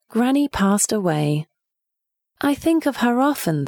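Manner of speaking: plain reading voice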